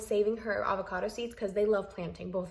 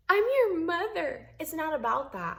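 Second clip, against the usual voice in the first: mother's retort; 8 semitones higher, 3 dB louder, slower than usual. Retold or imitated. Mother's retort in family fight.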